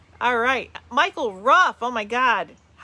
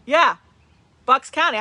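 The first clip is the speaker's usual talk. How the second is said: excited; 4 semitones higher, 9 dB louder than usual. Real, not acted. Repeats hometown excitedly.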